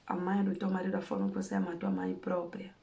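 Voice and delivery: normal talking voice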